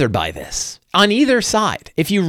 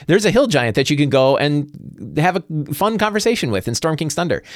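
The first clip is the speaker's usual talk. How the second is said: ordinary talk, nothing marked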